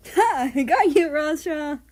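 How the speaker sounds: high, very loud gotcha shout; playful shout. Acted. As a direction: pounce with a triumphant yell. Japan jumps on friends playfully.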